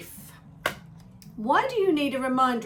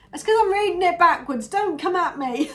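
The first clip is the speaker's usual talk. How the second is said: laughing; 7 semitones higher, 6 dB louder than usual. Real, not acted. Laughing at own mistake.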